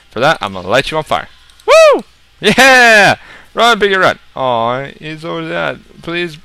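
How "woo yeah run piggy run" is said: high, loud whoop; gleeful cheer. Real, not acted. Player celebrating lighting the pig on fire, gleeful cheer. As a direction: whoop loudly in triumph.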